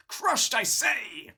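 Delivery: theatrical, high, drawn-out cry; mock outrage. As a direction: declaim it slowly in hammed-up indignation. Mock outrage over broken immersion.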